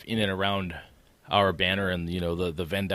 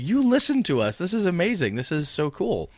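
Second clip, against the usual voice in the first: much higher, gushing, easing down by the end; retold delight; 10 semitones higher, 5 dB louder than usual. Host recalling his thrill that she listens, gushing and much higher. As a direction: squeal back at the fan in delight. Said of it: "I'm like".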